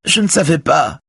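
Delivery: high, distressed woman's plea; acted crying voice, very high; tearful. A narrator's reading. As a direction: plead tearfully, pitched far up. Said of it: "dit Véronique en pleurant".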